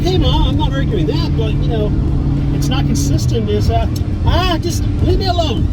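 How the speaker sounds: high retold back-and-forth; retold argument. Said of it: "I'm like".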